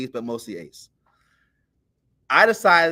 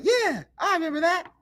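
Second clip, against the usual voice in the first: high-pitched mom impersonation; imitated mom. Mom confirming she remembers the story, imitated in a high voice. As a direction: answer in a put-on high mother's voice.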